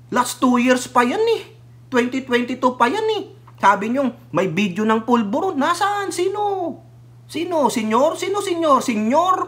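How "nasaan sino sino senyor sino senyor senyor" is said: incredulous questions. Real, not acted. Puzzled mocking questions.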